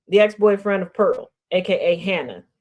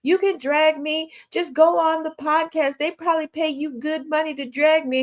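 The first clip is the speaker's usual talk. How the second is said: raised mock voice of the ex-girlfriend; mocking Pearl's reply; 6 semitones higher than usual. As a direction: imitate her brushing him off, pitched up. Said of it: "She tried to put out a response like".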